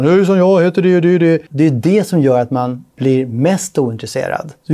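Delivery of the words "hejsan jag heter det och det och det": mock salesman greeting. Mocking a typical salesperson's phone greeting, hammed-up. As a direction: ham up a bored salesman's scripted hello. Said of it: "börjar så bara".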